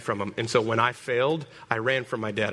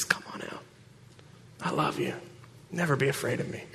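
much softer, near-whispered gentle call; softly reassuring words of God; tender God voice; 9 dB quieter than usual. Acted. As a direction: coax someone out of hiding, lovingly.

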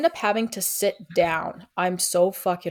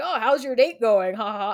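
imitated cheery mom, pitched higher at first; jovial mom; 4 semitones higher than usual. Mom calls about her daughter's date, mock jovial. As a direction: mimic a jovial mother with a mock laugh. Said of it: "she's all, like, jovial".

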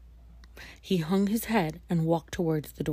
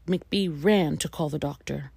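plain reading voice

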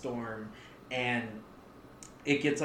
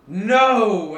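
character shout; 6 semitones higher, 9 dB louder than usual. Acted. Witch cries out, hitting bars.

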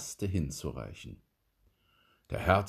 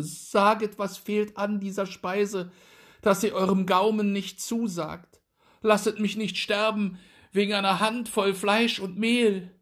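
high, pleading dwarf on his knees; desperate begging; 12 semitones higher, 4 dB louder than usual. A narrator's reading. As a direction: beg the guest for his life. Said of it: "rutschte auf den Knien zu dem Gast".

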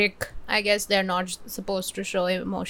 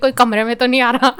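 laughing mock-sobbing, loud and rushed; retold sobbing; 4 semitones higher, 8 dB louder, much faster than usual. Friend retelling movie tears, laughing mock sob. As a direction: blurt it out through laughter, hamming up the tears. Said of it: "I'm, like, sobbing my eyes out".

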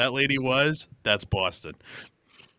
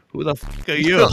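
put-on brash Boston accent, a touch louder; 3 semitones higher, 7 dB louder than usual. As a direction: toss it out like a tough Boston cop. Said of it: "with her Boston accent".

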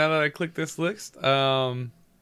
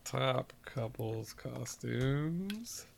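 slow drawn-out read; 5 semitones lower, 15 dB quieter, much slower than usual. Acted. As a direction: stretch each word slowly.

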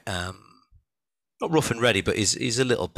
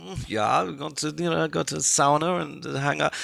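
higher, slightly camp German accent; mock German accent, pitched up and drawn out; 8 semitones higher, slower than usual. Shown as a demonstration. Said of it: "it sounds like slightly camp".

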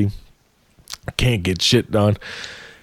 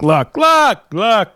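mocking high voice; 9 semitones higher, slower than usual. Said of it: "He's like".

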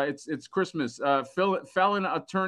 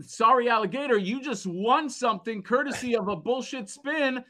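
higher-pitched, drawn-out winner announcement; prize announcer; 8 semitones higher than usual. Acted. Host telling Sorry Alligator he won, showy announcer. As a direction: build it up slowly for the big prize.

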